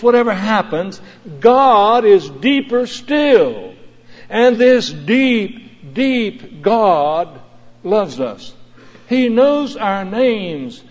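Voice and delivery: slow, swelling preacher's cadence; impassioned preaching. Acted. Pastor preaching to the congregation, fervent. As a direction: build it to a fervent climax.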